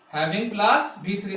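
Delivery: very slow, each part spaced; slow formula. Shown as a demonstration. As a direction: say the formula slowly for notes. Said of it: "teacher repeats formula". (धीरे-धीरे सूत्र)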